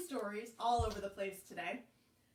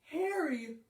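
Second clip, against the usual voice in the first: mock fright; 2 semitones higher, 10 dB louder, slower than usual. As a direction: play scared of the spider for kids. Pretend fright at spider.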